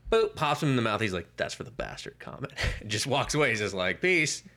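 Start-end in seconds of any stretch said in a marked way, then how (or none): 1.4-2.5 low retort. Retold or imitated. Retold pilot after punch. 4.0-4.4 mock peace. Retold pilot walking away.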